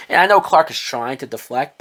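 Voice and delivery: ordinary talk, nothing marked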